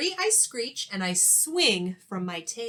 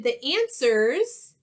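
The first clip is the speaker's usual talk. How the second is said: shouting; 3 semitones higher, 6 dB louder, slower than usual. Acted. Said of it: "we all shouted".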